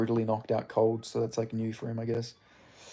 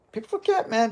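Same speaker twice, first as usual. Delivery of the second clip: mock podcast refrain; 10 semitones higher than usual. Streamer mocks a podcast refrain, voice pitched high. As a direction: tease the line like a podcast host.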